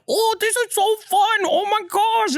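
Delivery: put-on overselling the fun for the toddler; sing-song fake enthusiasm to win over a child; fake delight. Faking fun on the mask.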